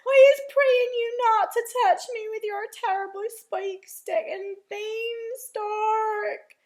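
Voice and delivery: high, loud, wailing panic; screamed pleading. A narrator's reading. Giant begging Jack to remove the beanstalk, screamed plea. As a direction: beg for mercy at the top of your voice. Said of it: "screamed the flesh lump eater".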